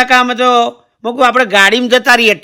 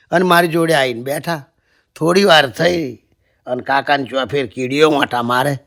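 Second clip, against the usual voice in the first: normal talking voice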